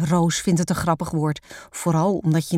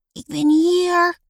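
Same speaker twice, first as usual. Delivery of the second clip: high, slow, stretched little voice; muffled calling; 12 semitones higher, 4 dB louder, slower than usual. A narrator's reading. Lotte answering her sister from the rolled-up rug, muffled. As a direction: draw it out high from inside a rug. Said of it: "klinkt zacht gemompel".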